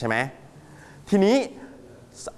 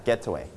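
GET-away, "getaway" falling from the first syllable; stress on first syllable; 5 semitones lower, 3 dB quieter, slower than usual. Shown as a demonstration. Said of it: "เราลงเสียงหนักที่พยางค์ที่หนึ่ง". (เน้นพยางค์แรก GET ในคำว่า "getaway")

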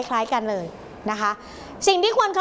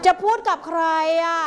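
higher, louder and impolite; rude question; 5 semitones higher, 4 dB louder than usual. Shown as a demonstration. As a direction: demand who they want, rudely. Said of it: "หลีกเลี่ยงคำถามต่อไปนี้".

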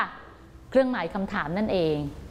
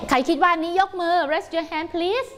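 cheerful teacher call; 6 semitones higher, much slower than usual. Acted. Teacher calls for hands.

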